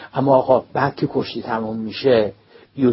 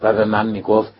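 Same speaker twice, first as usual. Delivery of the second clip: ordinary delivery, nothing marked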